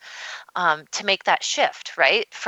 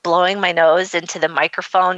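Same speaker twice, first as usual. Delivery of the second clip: ordinary delivery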